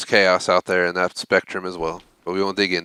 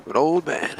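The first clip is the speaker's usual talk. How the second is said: a lightly voiced creaky old man, pitched up; 8 semitones higher than usual. Streamer joking about his age, lightly mock old man. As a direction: put on a hint of an old geezer.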